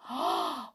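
quick gasp of wonder; excited gasp. Acted. Angela gasps with wonder.

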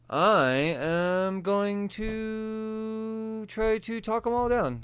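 hammed-up noble hero proclamation; mock heroic Superman. Superman announcing diplomacy to his son, slow mock-heroic. Superman declaring peaceful intent.

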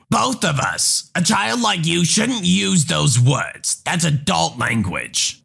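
slow, lightly voiced scolding mom; a hint of an indignant mother; offended mother. Mother scolding the teen for laughing at her daughter, slow.

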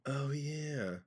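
breathy hushed oh yeah; whispered. Real, not acted. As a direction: whisper it in recognition. Whispered recognition.

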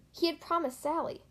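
ordinary delivery, nothing marked

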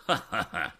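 acted mocking chuckle; spoken ha-ha laugh, put on; a jeering storybook laugh. A narrator's reading. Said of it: "laughed Andy".

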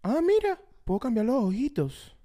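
high-pitched wonder at a gadget; mock video reviewer. Co-host parodying the second Vision Pro video, high mock wonder. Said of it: "Segundo video de Vision Pro".

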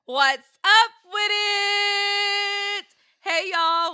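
high, loud, slow hello; high, loud, drawn-out show greeting; excited greeting. Real, not acted. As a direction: greet the viewers big and slow. Host greeting on show open.

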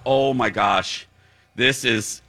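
slow relieved groans of massage pleasure. Real, not acted. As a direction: draw out the words as the knot gives way. Getting a deep shoulder massage.

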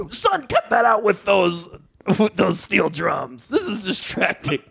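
a hint of fussy complaint; Frasier's dad, irritated. Dad telling son to stop steel drums, mild irritation.

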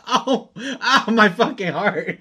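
high, wounded wail through laughter; hammed-up heartache, half laughing; mock heartbreak. Retelling hurt over a friend's joke, mock-heartbroken.

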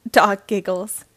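usual speaking voice

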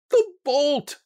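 put-on high, straining old woman's call; loud cry. A narrator's reading. Wife at the bolted door, straining loud cry. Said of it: "she cried loudly".

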